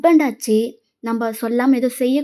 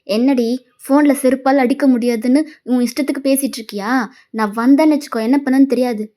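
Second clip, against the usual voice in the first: plain reading voice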